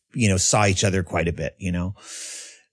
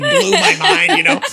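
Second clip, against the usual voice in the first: much higher, laughing and louder; 7 dB louder than usual. Real, not acted. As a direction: laugh out the amazement. Excited about Kung Fu Panda.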